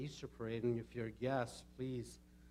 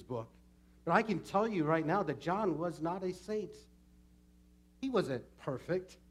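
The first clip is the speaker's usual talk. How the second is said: raised, emphatic complaint; Judas, indignant; 6 semitones higher, 6 dB louder than usual. Acted. Judas complaining to the congregation about John, indignant. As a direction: discredit a rival with rising indignation.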